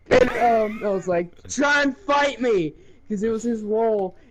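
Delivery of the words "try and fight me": slow, raised mock-tough dare; taunting challenge. [name] taunting his Risk opponent, slow raised mock-tough dare. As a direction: dare him to come at you.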